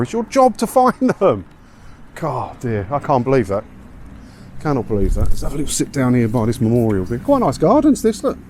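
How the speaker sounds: ordinary talk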